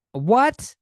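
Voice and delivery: high, loud, disbelieving yelp; appalled, pitched sharply up; incredulous. Acted. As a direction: burst out in baffled outrage. Outraged reaction to manager's question.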